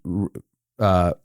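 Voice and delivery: ordinary delivery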